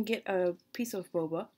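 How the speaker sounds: lips on spoon sipping; talking after a sip; slurping from a spoon. Real, not acted. Seen 1: Lips on spoon sipping boba liquid until 1.1s, then spoon lowered.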